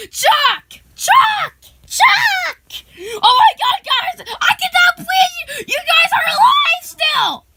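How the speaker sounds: high, loud shouting at a reunion; ecstatic disbelief. Acted. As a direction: shriek with joy at finding friends alive. Amazed to find friends alive.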